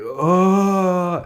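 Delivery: long, high wailed oh; retold cry of dismay. Fan hearing the breakup news, wailing oh. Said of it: "I was like".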